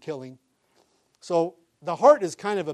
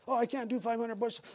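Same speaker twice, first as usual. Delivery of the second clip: high, softer whimpering protest; imitated scared kid; 7 semitones higher, 5 dB quieter than usual. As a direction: play a trembling kid pleading with a Marine.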